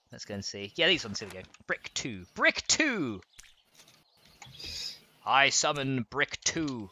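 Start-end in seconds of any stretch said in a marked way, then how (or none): 2.3-3.2 high, drawn-out call; mock summoning. Searching for the block, playful high summoning call. 5.2-6.9 slow, stretched-out summoning; mock incantation. Summoning a block in the game, slow mock incantation.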